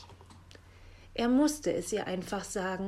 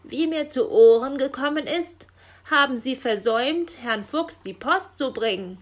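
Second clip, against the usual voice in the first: loud, raised official reprimand; stern scolding; 5 semitones higher, 9 dB louder than usual. A narrator's reading. Raven accusing Frau Elster of neglecting the post, stern scolding. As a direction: come down hard, like an indignant superior.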